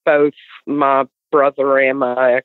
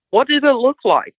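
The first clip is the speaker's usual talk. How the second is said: eager question; 10 semitones higher than usual. Retold or imitated. Wife asking about the creatures.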